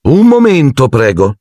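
richiamo forte e alto della professoressa; a voce alta. A narrator's reading. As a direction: ferma la classe prima che esca. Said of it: "esclamò a voce alta". (la McGonagall richiama gli studenti a voce alta)

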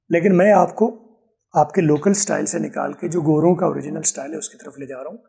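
ordinary talk, nothing marked

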